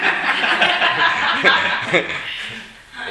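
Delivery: loud breathy laughter; a high, airless burst of laughter; helpless laughter; laughing. Real, not acted. Laughs at the name joke.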